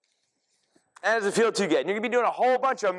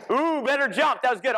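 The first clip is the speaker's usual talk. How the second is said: high, delighted coach's cheer; pleased whoop of approval; excited praise; 6 semitones higher, 4 dB louder than usual. Real, not acted. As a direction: praise the class with energy.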